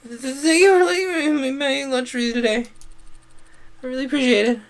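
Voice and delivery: high-pitched, flustered outburst; squeaky, overcome shout of gratitude; overwhelmed shout. Acted. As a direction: blurt out thanks in a high, stammering burst. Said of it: "overwhelmed with a sudden burst of feeling, shouted".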